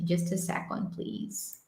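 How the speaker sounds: whispered aside. Real, not acted. Aside while fixing the screen.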